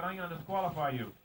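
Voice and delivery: usual speaking voice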